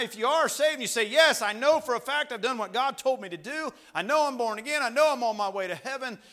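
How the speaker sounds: loud, high-pitched pulpit delivery; urgent preacher at full volume; full-force preaching. Real, not acted.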